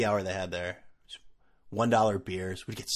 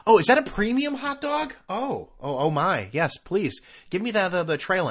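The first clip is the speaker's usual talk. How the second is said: high gushing fake excitement over a hot dog; mock delight; 8 semitones higher, 3 dB louder than usual. Podcaster mocking premium stadium food with fake gushing delight. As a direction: swoon sarcastically over fancy food.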